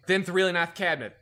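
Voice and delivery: imitating the chief's bad lisp; mock a heavily lisping boss; really bad lisp. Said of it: "he talked with a really bad lisp".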